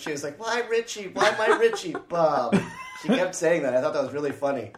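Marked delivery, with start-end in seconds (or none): 0.4-2.6 high, hammed-up wail; mother grieving and blaming Bob, hammed up; mock accusing mother.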